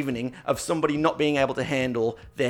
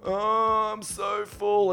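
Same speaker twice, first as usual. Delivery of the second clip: mock whiny moan, pitched higher; whiny full friend; 7 semitones higher, much slower than usual. S0 mimics his friend complaining about being too full, in a whiny moan. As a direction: groan like an overstuffed friend. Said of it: "Whole time".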